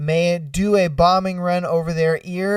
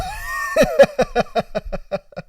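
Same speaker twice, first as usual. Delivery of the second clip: very high, helpless laughter; laughing. Real, not acted. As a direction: burst out laughing for real. Cracks up at his own squeal.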